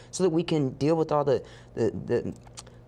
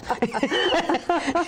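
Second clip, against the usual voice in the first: bright burst of laughter, pitched way up; high laugh; 14 semitones higher than usual. Real, not acted. Telling the host her story, she bursts out laughing. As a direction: laugh out at the memory.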